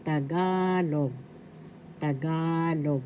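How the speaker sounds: Tagalog said slowly, GA drawn out; stress on second syllable. Shown as a demonstration. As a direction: stretch the middle of Tagalog: ta-GAA-log. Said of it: "The accent is in the second syllable".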